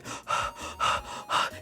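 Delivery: voiceless frantic panting breaths; imitated panting. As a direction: pant like a man in a panic. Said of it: "he panting".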